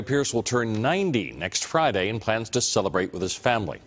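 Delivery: ordinary talk, nothing marked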